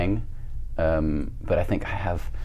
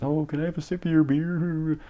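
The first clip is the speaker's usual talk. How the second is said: mimicked begging; 8 semitones higher, 4 dB quieter than usual. Kid begging older folks for a sip, mock wheedling. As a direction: plead in a high, soft put-on voice.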